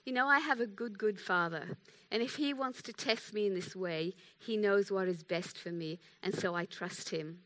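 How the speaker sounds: soft, gentle retold answer; sweet reply. Friend explains her trust in God, softly and sweetly. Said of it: "very beautifully and sweetly".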